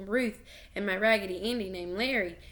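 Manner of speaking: usual speaking voice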